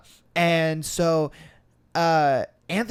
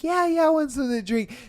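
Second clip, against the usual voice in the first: put-on eager girls; 9 semitones higher, faster than usual. Girls eagerly accepting a drink.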